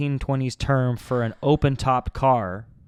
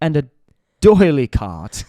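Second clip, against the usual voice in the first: put-on posh English accent; 3 semitones higher, 10 dB louder than usual. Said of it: "English ones that are, like, very fancy".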